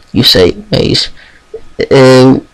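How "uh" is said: stuttering. Acted. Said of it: "she stutters out".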